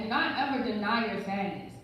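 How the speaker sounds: usual speaking voice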